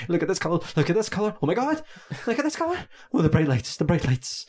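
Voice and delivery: put-on gushing like a thrilled kid; excited child. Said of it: "It's like a child, and it's just like".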